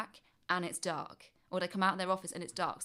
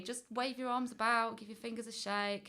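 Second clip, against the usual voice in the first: ordinary talk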